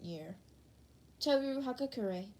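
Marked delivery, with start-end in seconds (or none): none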